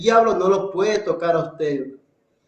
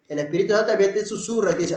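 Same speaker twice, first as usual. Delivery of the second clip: normal talking voice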